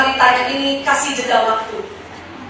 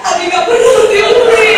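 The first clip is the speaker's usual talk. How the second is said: high, rising mock protest; hammed-up outburst; 7 semitones higher, 5 dB louder than usual. Spouse's outburst after the third asking, hammed-up. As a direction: burst out high, half laughing.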